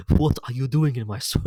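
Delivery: a clumsy put-on Scottish accent, a bit loud; mock Shrek in a rough Scottish accent; bad Scottish accent. Vlogger quoting Shrek in a bad Scottish accent. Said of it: "I tried doing a Scottish accent for that".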